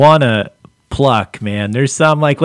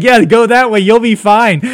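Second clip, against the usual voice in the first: mock friendly; 7 semitones higher, 6 dB louder than usual. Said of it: "You're like".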